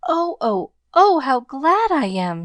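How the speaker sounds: high, loud, overjoyed gasp; a girl overwhelmed with joy; breathed with joy. A narrator's reading. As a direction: let the gladness spill out high and bright. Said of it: "she breathed".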